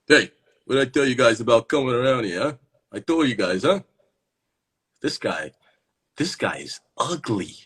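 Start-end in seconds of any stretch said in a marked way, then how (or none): 0.1-3.8 louder, fast, swooping character voice; guy scolding kids. Acted. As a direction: scold the kids loudly in a put-on voice. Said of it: "Does this guy have this voice?".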